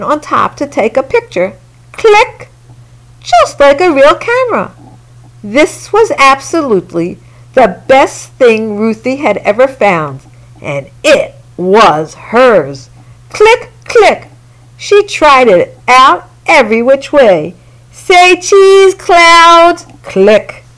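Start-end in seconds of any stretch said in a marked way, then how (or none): 1.9-2.5 high, bright mouth click like a shutter; playful click noise; camera click. A narrator's reading. Camera shutter sound in story. 13.3-14.3 high, bright mouth click like a shutter; playful click noise; camera click. A narrator's reading. Camera shutter sound in story. 17.9-19.9 higher and a bit louder, sing-song say cheese; playful photographer voice; cheery photo call. A narrator's reading. Ruthie taking photos happily.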